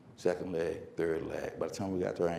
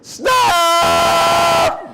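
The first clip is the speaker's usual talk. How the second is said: piercingly high, long held scream; screamed command; 12 semitones higher, 11 dB louder than usual. Retold or imitated. Girl screams as she hits the relay mark, full-force yell. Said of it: "she screams".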